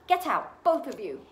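loud, strained order; croaked. A narrator's reading. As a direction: order them out, starting high and loud. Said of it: "croaked Uncle Vernon".

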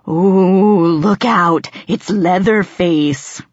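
hammed-up slow spooky monster call; mock monster. A narrator's reading. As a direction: pretend to be a horror villain, mockingly. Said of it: "made a grotesque face and staggered".

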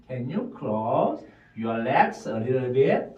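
slow, in two chunks; slow full sentence. Shown as a demonstration. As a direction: model the full sentence slowly. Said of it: "두 묶음". (두 묶음으로 천천히)

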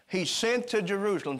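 ordinary delivery

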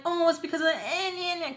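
high mocking whiny voice; mocking whiner. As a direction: put on a high voice to mock a critic. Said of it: "if you're like".

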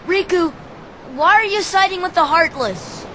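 high, urgent confrontation; pleading challenge. Acted. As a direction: demand answers from a friend. Confronts friend who changed sides.

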